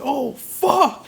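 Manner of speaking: very high, drawn-out mock gasp of shock; audience reaction. Imitating a cinema audience at the yelling scene, high mock shock. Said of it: "The whole audience like".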